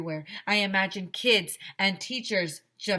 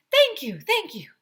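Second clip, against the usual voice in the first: much higher, louder lady's voice; firefighter lady; 13 semitones higher, 9 dB louder than usual. Acted. Firefighter thanking the applauding children, high and cheerful. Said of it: "said Missus Meyer".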